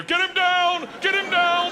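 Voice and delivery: high, loud repeated command; shouting orders. Retold or imitated. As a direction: bark it like a gladiator. Sound guy fending him off.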